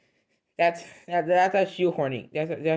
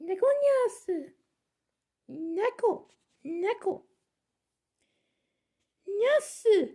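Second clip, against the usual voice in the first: very high kitty voice saying "Neko nyasu"; cat voice; slower than usual. Shown as a demonstration. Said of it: "I'll just be like this".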